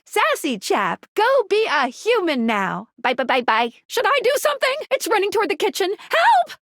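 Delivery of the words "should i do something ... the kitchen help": high, hurried comic panic; flustered and squeaky with alarm; panicked. Acted. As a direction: build to a high-pitched cry for help. Acted panic over a runaway.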